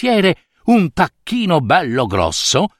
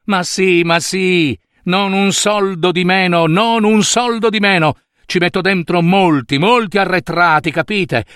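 higher, excited, pressing generosity; eager insistence; 5 semitones higher than usual. Acted. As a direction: insist happily, brushing off any doubt. (insistente ed entusiasta)

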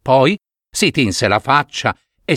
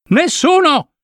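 a sudden cry, much higher; urgent warning shout; slower than usual. Acted. (i nani gridano l'avvertimento, acuto e forte)

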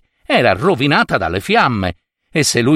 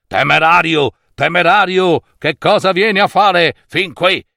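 challenging wizard, rising higher; indignant wizard; 6 semitones higher than usual. A narrator's reading. Wizard inside the palace challenges the reckless visitor, indignant. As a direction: voice an affronted sorcerer challenging an intruder.

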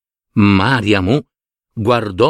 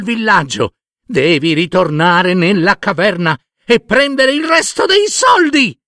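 high-pitched berating wife; furious wife; 9 semitones higher than usual. Acted. Wife orders her husband back to the cave, high furious scolding. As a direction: order him about, high and exasperated.

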